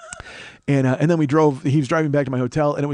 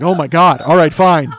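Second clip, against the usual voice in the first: loud, higher mock outburst; imitated exasperated clerk, much louder; exasperated relief; 4 semitones higher, 10 dB louder than usual. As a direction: play the clerk blurting it out, relieved and fed up. Clerk told it was a joke.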